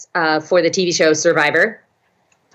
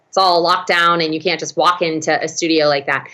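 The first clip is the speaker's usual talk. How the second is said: ordinary talk, nothing marked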